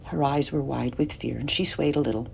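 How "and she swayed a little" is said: plain reading voice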